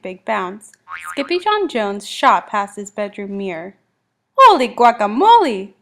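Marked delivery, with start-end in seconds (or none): 4.4-5.7 loud, amazed outburst; startled kitten's exclamation; exclaiming. A narrator's reading. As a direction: cry out in astonishment. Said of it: "exclaimed Skippy John Jones".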